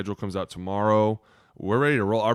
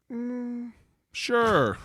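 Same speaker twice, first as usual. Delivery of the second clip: sarcastic doubtful sure. Retold or imitated. Nurses' doubtful answer.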